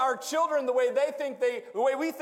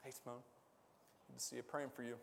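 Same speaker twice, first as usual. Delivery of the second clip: quiet aside; 9 semitones lower, 19 dB quieter, much faster than usual. Real, not acted. Greeting someone aside.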